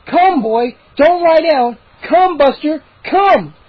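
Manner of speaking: high loud calling; shouting. A narrator's reading. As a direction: shout at the dog. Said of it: "shouting over the chants".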